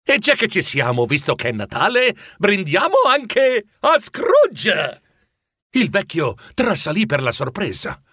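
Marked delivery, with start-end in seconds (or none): 0.0-5.0 festive toast. A narrator's reading. Bob toasting Scrooge at Christmas, building and jubilant.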